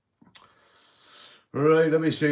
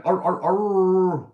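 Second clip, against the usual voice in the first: high, loud, slow arr arr arr; mock dog growl; 7 semitones higher, 7 dB louder, much slower than usual. Husband in the joke hamming up doggy style with slow growls.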